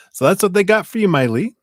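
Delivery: high, loud, bubbly greeting; excited shout-out. Real, not acted. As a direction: beam it out to the donor. Cheerful shout-out to a donor.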